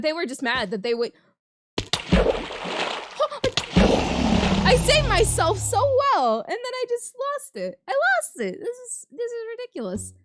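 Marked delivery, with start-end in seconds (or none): 1.8-4.6 high breathy gasp of shock; sharp gasp. Real, not acted. Caught out mid-game, high shocked gasp. 4.6-10.1 exasperated. Real, not acted. Losing in the game, high exasperated outcry.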